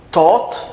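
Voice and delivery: "Taught" with hard T, high rising tune; T sound. Shown as a demonstration. Teacher recaps all the words, "Taught" with T, rising. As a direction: start "Taught" on T and lift the end. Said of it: "Com som de T".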